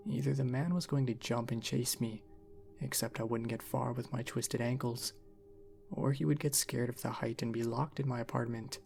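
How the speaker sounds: plain reading voice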